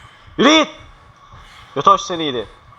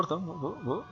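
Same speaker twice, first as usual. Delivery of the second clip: hushed in fear; 18 dB quieter than usual. Real, not acted. Scared, voice drops very low.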